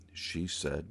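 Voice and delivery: ordinary talk, nothing marked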